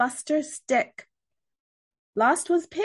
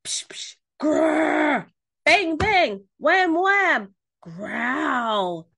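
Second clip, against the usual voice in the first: loud, higher bangs and growls; yelled monster noises; 5 semitones higher, 4 dB louder, much slower than usual. A narrator's reading. Pig telling Duck how the monster goes, yelling. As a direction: act out the noises at full tilt. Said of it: "Pig yelled".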